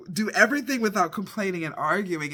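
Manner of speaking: ordinary talk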